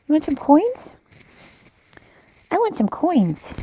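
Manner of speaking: "coins" for corn in a high put-on voice; Yaya saying coins. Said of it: "the way she would say coins".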